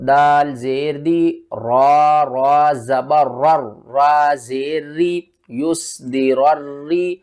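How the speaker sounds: slow tajweed spelling. Shown as a demonstration. Teacher spelling the word, slow. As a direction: spell it slowly. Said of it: "ہجے کر کے سمجھاتا ہوں".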